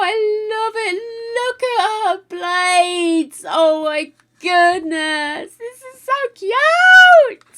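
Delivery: high, loud squealing delight; giddy excitement. Real, not acted. Streamer gushing over a present from Harb, giddy squeals. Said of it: "I'm a bit giddy".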